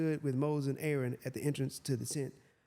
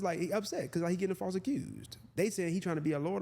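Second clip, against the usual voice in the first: ordinary talk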